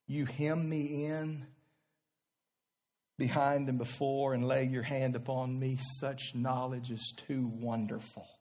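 quiet reverent psalm recital; poem reading. Acted. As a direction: read it like a hushed prayer. Psalm read softly.

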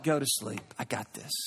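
God hushing a restless worrier softly. Retold or imitated. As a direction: drop to a soft, low hush. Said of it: "God just like".

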